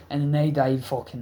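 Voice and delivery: plain reading voice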